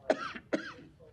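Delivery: harsh choking cough; coughing fit. Real, not acted. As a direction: cough hard, throat caught. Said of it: "Oh, I'm choking".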